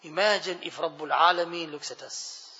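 ordinary delivery

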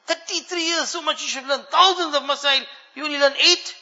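high-pitched, disbelieving reproach; indignant, exasperated teacher; appalled teacher. Retold or imitated. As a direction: scold a disappointing student, pitch lifted throughout. Teacher appalled at his student.

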